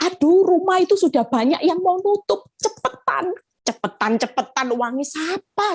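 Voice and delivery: high-pitched, incredulous retort; retold fussy outburst, rising high; exasperated protest. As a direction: imitate an exasperated "who's got the money?" comeback. Retold exasperated reply to pushy friend.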